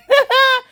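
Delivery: squealing laugh; high burst of laughter. Real, not acted. Vlogger laughing at the recap.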